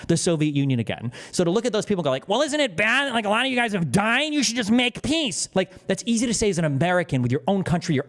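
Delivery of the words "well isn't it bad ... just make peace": high-pitched, whiny-naive mimicry; mock them in a raised, clueless pleading voice; mock naive Westerner. Mimicking Americans telling Ukrainians to make peace, mock-naive.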